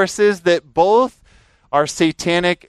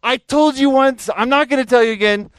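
put-on higher, angry warning to the kids; a retold flash of temper, pitched up; 9 semitones higher, 3 dB louder than usual. Scolding the Frisbee kids near his car, sharp angry warning. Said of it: "And I was so angry".

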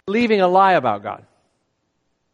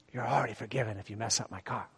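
much softer, murmured kindly; quiet aside; 9 semitones lower, 15 dB quieter than usual. Retold or imitated. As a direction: hush it so she isn't embarrassed. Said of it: "quietly so I wouldn't embarrass her".

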